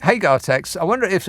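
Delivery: ordinary talk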